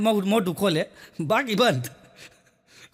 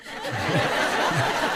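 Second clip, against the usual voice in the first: laugh; 7 semitones lower than usual. Real, not acted. Comedian laughs at own joke.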